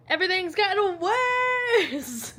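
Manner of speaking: slow, drawn-out wail rising high; half-laughing storm update, stretched out for drama; dramatic alarm. Real, not acted.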